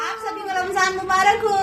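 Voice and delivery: high, soft coaxing voice. Real, not acted. Mother prompts child to repeat greeting.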